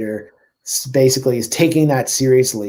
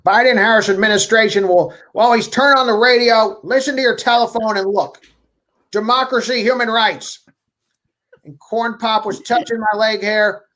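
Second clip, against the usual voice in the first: put-on high-pitched, rambling old-politician parody; Biden impression; 8 semitones higher than usual. Host reads a tweet as a rambling Biden, high put-on voice. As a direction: put on a high, stumbling presidential voice, losing the thread. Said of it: "read this in your Biden voice".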